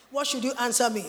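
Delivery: ordinary delivery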